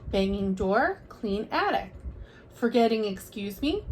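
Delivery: usual speaking voice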